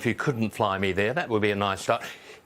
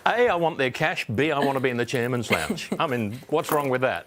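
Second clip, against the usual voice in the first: usual speaking voice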